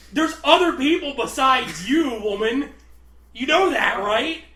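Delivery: loud, high exasperated tirade; mock snapping. Box guy berating coworker as a joke, mock-furious rant. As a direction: rant at them, hammed-up. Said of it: "I just fucking snapped".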